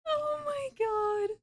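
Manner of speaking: high, slow, drawn-out groan; spent from laughing. Real, not acted. Recovering from a laughing fit, high slow exasperation.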